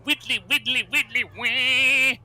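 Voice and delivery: high, slow singsong widdly-wee; widdly wee bit. Retold or imitated. Cubot messing around, doing a high singsong widdly wee. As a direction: squeal it out playfully. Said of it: "trying to do his best Carl".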